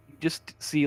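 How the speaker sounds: ordinary delivery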